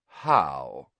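low, cold drawled question. A narrator's reading. Draco Malfoy challenging his teacher, cold drawl. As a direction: drawl it with icy disdain. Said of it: "said the cold, drawling voice".

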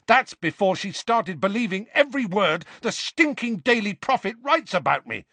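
high, heated protest. A narrator's reading. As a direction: protest with the voice pushed right up. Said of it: "Harry at the top of his voice".

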